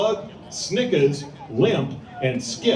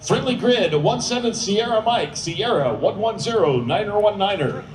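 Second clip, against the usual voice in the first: urgent, higher radio calls; military radio controller; 4 semitones higher than usual. Acted. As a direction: bark out the grid like a JTAC. Announcer voices ground controller.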